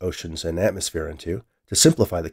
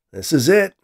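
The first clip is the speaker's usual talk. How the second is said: higher, louder burst of nervous excitement; excited trepidation; 8 semitones higher, 6 dB louder than usual. A narrator's reading. Scientist before his big experiment, excited and apprehensive. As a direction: announce it keyed up, pitch raised. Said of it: "stated with excitement and trepidation".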